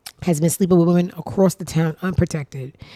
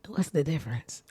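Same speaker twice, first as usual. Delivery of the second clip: ordinary delivery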